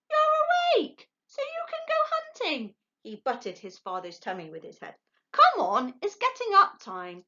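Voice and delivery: very high, loud little owl; gotcha, squealed; triumphant owlet. A narrator's reading. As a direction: owlet catching out his father, squeaky.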